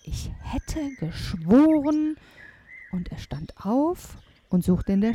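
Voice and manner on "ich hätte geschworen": slow, puzzled giant voice, pitched up; drawn-out storybook mumbling, not hushed; murmuring giant. A narrator's reading. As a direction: grumble it out, stretching each word. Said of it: "murmelte er".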